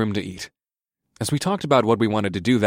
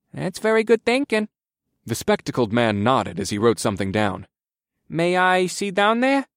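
timid inspector voice; 6 semitones higher than usual. A narrator's reading.

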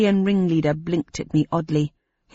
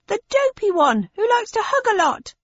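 high crowd voice. Acted. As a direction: jeer from the back of the crowd. Same jeering crowd voice.

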